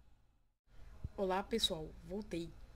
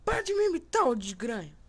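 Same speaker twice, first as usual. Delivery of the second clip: much higher, loud mock yell; mock angry shout; 4 semitones higher, 13 dB louder than usual. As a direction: yell at the mirror to stop copying. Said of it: "Fica imitando o cara". (gritando com o espelho para parar de imitar)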